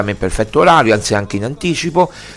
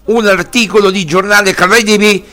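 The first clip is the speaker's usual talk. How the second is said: indignant; 7 semitones higher than usual. Real, not acted. Outraged protest about press coverage.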